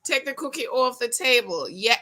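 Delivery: usual speaking voice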